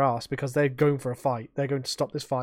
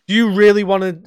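louder cocky challenge; threatening dare; 4 semitones higher, 10 dB louder than usual. Retold or imitated. Facing burly blokes, a loud cocky dare. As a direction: dare them to try. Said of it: "and I'm like".

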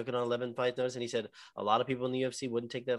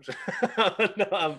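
laughing; 9 semitones higher, 5 dB louder, much slower than usual. Real, not acted. Laughing off his sarcasm.

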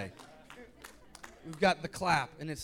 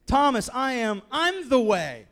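Jesus insisting; 6 semitones higher, 8 dB louder than usual. Retold or imitated. Jesus answering Thomas, louder.

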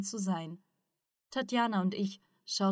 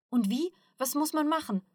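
higher, eager questioning; impatient; 7 semitones higher than usual. A narrator's reading. Ruslan presses Sensei for instructions, impatient. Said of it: "fragte Ruslan ungeduldig".

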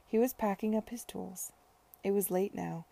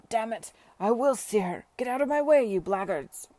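loud, raised-pitch outburst building to a peak; angry drunken shout; 2 semitones higher, 9 dB louder than usual. A narrator's reading. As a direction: shout as if forcing your way through a crowd. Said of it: "it was Sir Edward who shouted".

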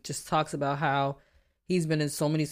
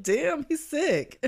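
high, slow laughing quote; 11 semitones higher, much slower than usual. Retold or imitated. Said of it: "I'm like".

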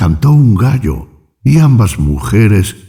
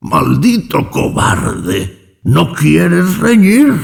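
slow, strained cry that leaps up in pitch; angry shout; 9 semitones higher, much slower than usual. A narrator's reading. Old hermit taunts his younger brother, slow furious cry. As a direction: hurl the insult, then demand a fight. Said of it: "gritó".

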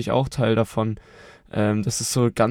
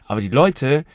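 ordinary delivery

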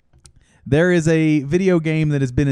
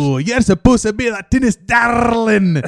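loud higher mock rant; mock shout; 6 semitones higher, 5 dB louder than usual. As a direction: rant at the goofball player. Coach shouting.

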